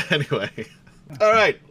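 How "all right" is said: excited kickoff. Real, not acted. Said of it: "launching the session".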